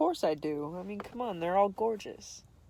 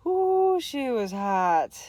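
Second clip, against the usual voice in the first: high ooh then a slow she was hot; impressed ooh; excited ooh; 6 semitones higher, 6 dB louder, much slower than usual. Real, not acted. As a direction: swoon over her. Reacting to attractive woman.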